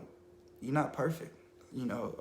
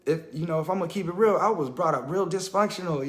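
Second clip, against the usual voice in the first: ordinary delivery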